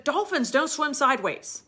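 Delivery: hammed-up angry trainer; angry objection. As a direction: snap it like an indignant trainer. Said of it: "got really angry".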